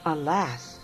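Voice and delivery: usual speaking voice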